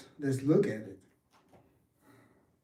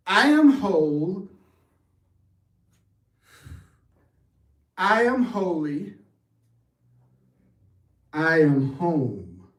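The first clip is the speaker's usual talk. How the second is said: unhurried, solemn declaration, slowing on home; heartfelt affirmation; 3 dB louder, slower than usual. Shown as a demonstration. Leading the class in a heartfelt affirmation. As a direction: let each line land as a sacred truth. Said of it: "So say it with me in your heart".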